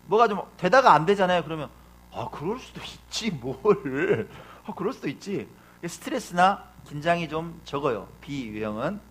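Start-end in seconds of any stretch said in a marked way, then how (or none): 2.1-5.5 soft, relaxed shrug of a voice; easygoing Type B. Acted. Preacher plays laid-back Type B when things go wrong, soft and easygoing. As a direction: let it go lightly and softly. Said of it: "좀 느긋해요".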